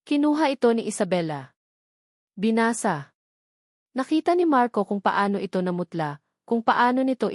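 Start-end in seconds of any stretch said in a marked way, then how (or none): none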